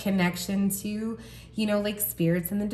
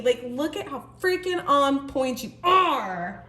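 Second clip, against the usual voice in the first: sung-out, much higher oh my God, slow; delighted; 8 semitones higher than usual. Real, not acted. Reader thrilled by how equal the cards are, sung-out delight. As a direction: let the joy lift your voice into song.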